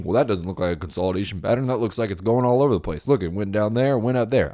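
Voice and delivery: lightly put-on country accent as a doubting viewer; mock a skeptical viewer in a hint of a country accent. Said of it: "I gave you a country accent".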